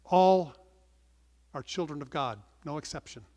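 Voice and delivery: normal talking voice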